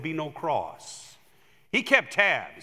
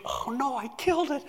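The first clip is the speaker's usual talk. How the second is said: higher, alarmed little gasp of a line; mock dismay; 9 semitones higher than usual. Pastor voices the lady panicking over the frozen parrot, higher mock dismay. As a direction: play the lady's sudden fear she killed it. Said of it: "And she went".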